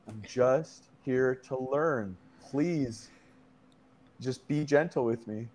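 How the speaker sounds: slow, low and soft; meek plea. Retold or imitated. Tripper begging the yelling minotaur for mercy, soft plea. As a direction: plead gently with the monster, hushed.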